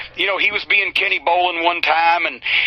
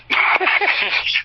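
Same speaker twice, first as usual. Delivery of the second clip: breathy laugh; 10 semitones higher than usual. Real, not acted. Laughing at his own story.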